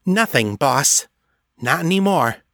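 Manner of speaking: high-pitched elf reporting to the boss; female elf voice. A narrator's reading. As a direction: pitch it up, rising on the final words. Female elf answering the master.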